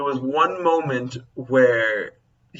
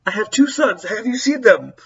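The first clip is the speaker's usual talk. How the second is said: high, louder panicked mother from the film; quoting the worried mom, pitched up and urgent; frantic mom; 8 semitones higher, 4 dB louder than usual. Acted. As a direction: beg for help like a scared mom. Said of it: "she goes".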